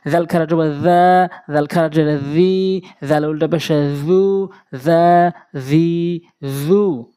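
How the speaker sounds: long, level-held vowels in জা, জি, জু; one-alif elongation. Shown as a demonstration. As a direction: hold the vowel of each জা, জি, জু for about two beats. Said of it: "একটু টান হবে। যেমন".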